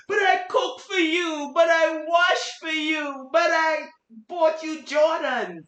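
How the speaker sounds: high, loud, drawn-out wail of protest; mock desperate pleading. Woman pleading with the man who left her, loud and high. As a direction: cry out like a woman being dumped.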